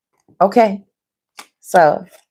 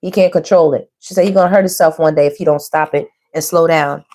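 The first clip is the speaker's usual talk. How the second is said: normal talking voice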